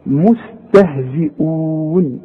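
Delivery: مستهزئون slow with thin letters; tajweed demo of مستهزئون; light recitation. Shown as a demonstration. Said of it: "كلها مرققة".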